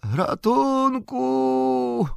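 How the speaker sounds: very slow, drawn-out high cry from afar; distant cry for help. Acted. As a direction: stretch the cry like a fading wail. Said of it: "krzyk jakby".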